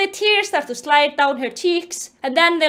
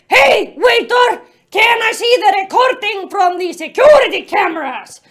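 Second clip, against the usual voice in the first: hammed-up calling out; 5 semitones higher, 6 dB louder than usual. Officer hailing the waiter, hammed-up shout. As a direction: holler theatrically across the restaurant.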